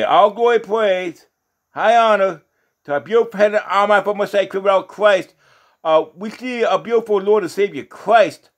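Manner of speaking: preacher lifting up praise to close; closing doxology. Real, not acted. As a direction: proclaim the glory in ringing phrases.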